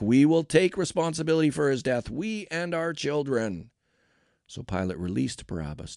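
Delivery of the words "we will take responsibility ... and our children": high yell, slowing at the end; yelled back. A narrator's reading. People answering Pilate, yelling back. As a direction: call it back as a crowd, starting loud. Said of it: "all the people yelled back".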